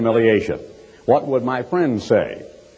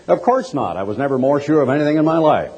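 ordinary talk, nothing marked

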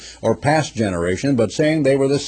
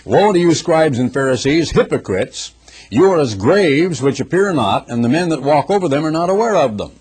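raised, louder preaching of the woe; preaching scripture; 5 semitones higher, 3 dB louder than usual. Acted. Preacher declaiming Christ's woes to Pharisees. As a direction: proclaim it to the hypocrites. Reads Jesus's words in preaching voice.

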